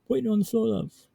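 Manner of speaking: high-pitched British nurse; British accent. Acted. Nurse finding a patient on the floor, British accent. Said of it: "That's a fantastic British accent".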